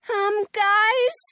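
high, loud call over noise. A narrator's reading. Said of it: "Robin calls over the whirring noise".

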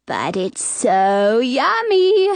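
slow, drawn-out tempting voice; coaxing. A narrator's reading. Old lady holding out the apple, coaxing. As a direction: stretch the words to tempt. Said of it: "the lady coaxes".

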